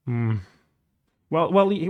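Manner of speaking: ordinary delivery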